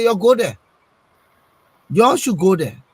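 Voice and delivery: a loud order, retold; commanding. Party chairman sending his supporters off to the president, loud. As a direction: bark it like the chairman giving orders. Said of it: "He said".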